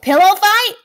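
put-on high, loud eager kid; little sister begging to play; excited shout. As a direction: yell it with glee.

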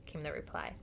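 usual speaking voice

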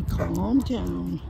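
ordinary talk, nothing marked

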